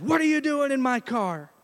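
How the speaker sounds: startled and ready to fight; confronting an intruder. Retold or imitated. Startled Robert confronts car intruder.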